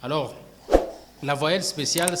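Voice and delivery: ordinary talk, nothing marked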